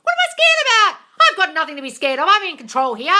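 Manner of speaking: very high, loud yell at yourself; loud self-scolding demo. Shown as a demonstration. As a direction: shout yourself down. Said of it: "Yell at yourself".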